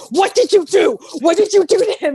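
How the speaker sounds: high, loud mock panic; hammed-up accusing yell at Luke; mock frantic Rey. Rey smacking Luke, retold.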